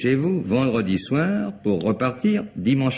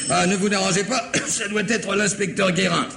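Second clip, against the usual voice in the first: coughing while talking; 11 semitones higher than usual. Acted. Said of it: "je supporte mal la naphtaline".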